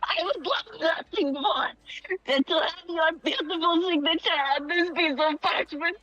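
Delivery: high, earnest begging voice; pleading fan. Acted. Fan kneels, begging for signature.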